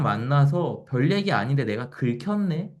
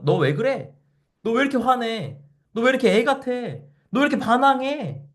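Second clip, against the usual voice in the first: scolding questions; 8 semitones higher than usual. Retold or imitated. Someone scolding, rising to nagging.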